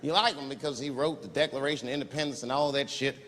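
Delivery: ordinary talk, nothing marked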